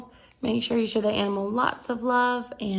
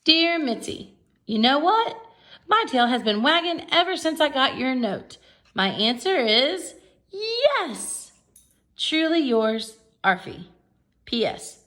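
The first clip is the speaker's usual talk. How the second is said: high, louder eager dog voice; dog's letter read aloud; 6 semitones higher, 5 dB louder, slower than usual. Acted. Teacher voicing Arfie's reply to Mitzi, high and eager. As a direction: read it like a happy dog wrote it.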